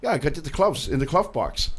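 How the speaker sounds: "gloves" said as "cloves", with a hard C; old German saying "glove" as "clove"; muddled G's and C's. Retold or imitated. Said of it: "hard times with G's and C's".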